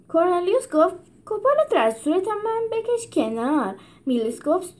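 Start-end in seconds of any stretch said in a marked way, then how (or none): 1.3-3.7 annoyed animal. A narrator's reading. Animals crammed in cage.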